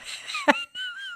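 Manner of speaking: high, breathy guilty laugh; a cringing, sheepish laugh. Real, not acted. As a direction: laugh out of embarrassment. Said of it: "I'm laughing 'cause I feel so bad".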